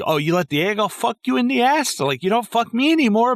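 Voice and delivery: high falsetto mock of the jealous wife; wife taunting him in the car, high mocking falsetto. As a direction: whine it way up high like her. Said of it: "Right, and saying".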